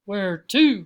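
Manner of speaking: loud, gruff grunt; brusque cab driver's grunt; grunting taxi driver. A narrator's reading. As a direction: grunt it out like a cabbie. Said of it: "grunted the taxi driver".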